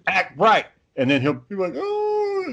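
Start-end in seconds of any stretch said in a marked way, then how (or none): 0.1-0.7 short, higher commands in a dad voice; dad yelling. Shown as a demonstration. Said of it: "the dad voice, the dad yelling". 1.8-2.5 very high imitated child's wail; mock crying. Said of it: "go crying and stuff".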